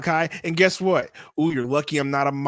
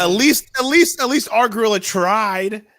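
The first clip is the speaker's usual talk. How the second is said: higher and louder insistence; excited; 8 semitones higher, 5 dB louder than usual. Real, not acted. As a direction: protest it eagerly. Defending team excitedly.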